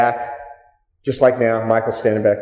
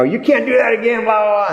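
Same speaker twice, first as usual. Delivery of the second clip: high, whiny heckler protest, mocked; 7 semitones higher than usual. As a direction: squeak out the hecklers' complaint.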